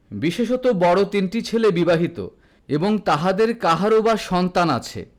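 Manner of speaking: ordinary delivery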